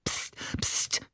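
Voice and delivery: soft, voiceless hiss; whispered warning to be quiet; hissed shushing. A narrator's reading. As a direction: hush them urgently under your breath. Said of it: "zischte Ali".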